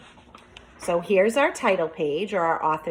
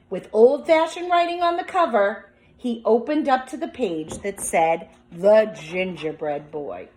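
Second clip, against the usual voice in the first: put-on reading aloud to children; 3 semitones higher, 4 dB louder than usual. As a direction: draw the class into the story.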